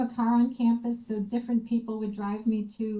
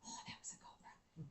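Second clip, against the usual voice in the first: hushed, stunned whisper; whispered shaken realisation; shocked whisper; 7 semitones lower, 26 dB quieter than usual. Retold or imitated. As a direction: breathe it out in disbelief. Reacting after shutting door.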